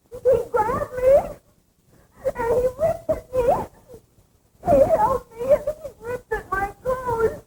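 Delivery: high, sobbing, breaking voice; sobbing account. Acted. Barbara sobbing as she relives the attack. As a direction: sob it out in terror.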